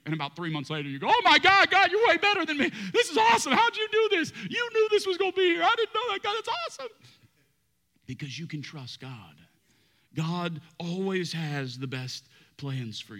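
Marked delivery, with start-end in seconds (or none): 1.1-6.9 high, loud gushing thanks; mock delight. Pastor mimicking believer thanking God, mock delight. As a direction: gush like an amazed kid.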